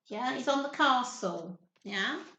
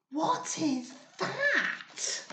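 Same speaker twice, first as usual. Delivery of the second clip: gasping surprise; 2 semitones higher, much slower than usual. Acted. Gasp at the huge eyes.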